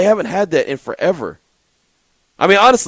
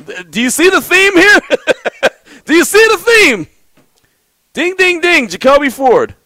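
mock excited; 13 semitones higher, 6 dB louder than usual. Real, not acted. Host mocks recurring answer.

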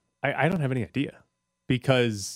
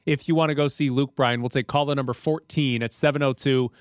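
high, loud upbeat radio announcer; radio contest promo; 4 semitones higher, 4 dB louder than usual. Acted. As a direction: pitch the giveaway like a DJ. Host reads ticket giveaway.

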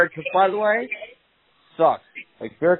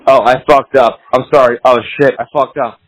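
louder, urgent retold apology; panicked apology; 3 semitones lower, 9 dB louder than usual. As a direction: blurt the apology in a rush.

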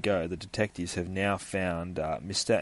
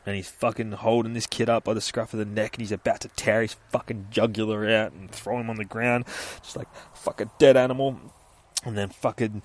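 ordinary delivery

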